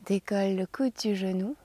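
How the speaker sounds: normal talking voice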